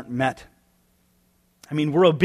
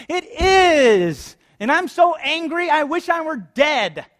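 put-on much higher and louder, a whiny little kid; toddler voice; 11 semitones higher, 4 dB louder, slower than usual. Preacher mocking Jonah as a sulking toddler, high whiny voice. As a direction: play Jonah as a pouting toddler, stretching the end out slowly. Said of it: "going into a voice of a toddler".

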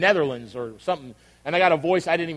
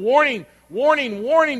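high, insistent repeated warning; mock warning alert; 7 semitones higher, 3 dB louder than usual. God warning the listener of hazards, insistent alert. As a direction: sound the alert, pitched up.

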